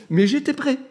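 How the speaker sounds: ordinary talk, nothing marked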